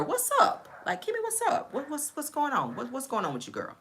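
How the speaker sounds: high, soft voice sliding down as the questions go on; gently probe, mimicking a concerned friend; concerned friend imitation. Voices a worried friend asking.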